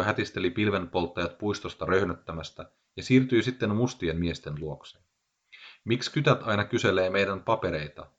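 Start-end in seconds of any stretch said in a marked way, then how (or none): none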